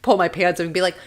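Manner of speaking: ordinary delivery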